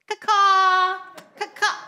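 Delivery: loud bird call; chirping bird person. Pretend. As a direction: caw like a crow. Bird calls for volunteers.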